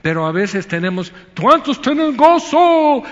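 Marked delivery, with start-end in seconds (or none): 1.4-3.0 much higher, louder, slow crowd-pumping call; mock hype preacher. Preacher imitating a pushy worship leader working the crowd. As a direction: ham up the cheerleading worship leader.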